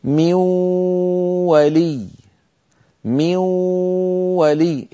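من ولي بنون مدغمة في الواو مع غنة; انتقل إلى الواو مباشرة بغنة; إدغام بغنة. Shown as a demonstration. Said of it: "مثال الواو". (إدغام النون في الواو بغنة)

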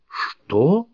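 high, rising cry of disbelief; shocked exclamation. A narrator's reading. Shelby and Martin react to the claim, exclaiming. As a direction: blurt it out, astonished. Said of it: "одновременно воскликнули Шелби и Мартин".

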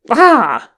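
loud, high yelp of alarm; sharp startled cry. A narrator's reading. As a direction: yelp out suddenly, pitch leaping then falling. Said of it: "Gimli yelped".

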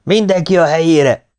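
high, loud order from above; ringing command. A narrator's reading. Sergeant ordering everyone to posts, ringing shout. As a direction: ring out the order from the tower. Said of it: "csendült meg a magasból".

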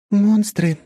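high, loud goddess voice. A narrator's reading. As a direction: answer lightly, like a goddess.

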